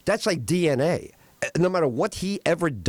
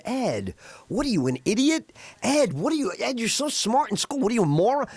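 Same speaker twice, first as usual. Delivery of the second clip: higher, teasing mock scold as Gary; mock scolding friend; 7 semitones higher, faster than usual. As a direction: needle a buddy for being dumb, higher pitch. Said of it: "Gary has to tell you".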